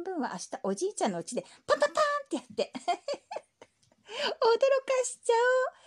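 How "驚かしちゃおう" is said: high loud gleeful; excited glee. Acted. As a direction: relish the prank. Mischievous plan. (大喜びで)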